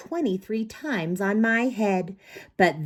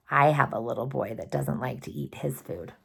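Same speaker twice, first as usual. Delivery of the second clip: ordinary delivery, nothing marked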